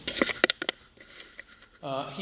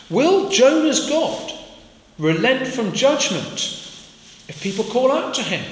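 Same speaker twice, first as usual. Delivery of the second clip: rising emphasis; 9 semitones higher than usual. Real, not acted. Preacher's rhetorical climax question.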